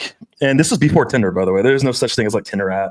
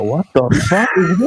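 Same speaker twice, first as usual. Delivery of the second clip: higher, laughing what-the-fuck; half-laughing at the absurdity; laughing disbelief; 7 semitones higher than usual. Retold or imitated. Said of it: "And I go".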